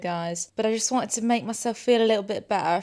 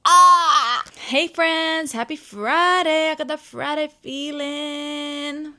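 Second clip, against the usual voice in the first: high, loud and cheery, slowed; sunny Friday hello; bubbly greeting; 7 semitones higher, 8 dB louder, much slower than usual. Real, not acted. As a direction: sing it out to the camera.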